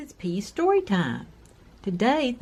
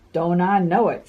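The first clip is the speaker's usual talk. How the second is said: mild sigh; 5 dB louder than usual. Acted. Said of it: "sighed the little red caboose".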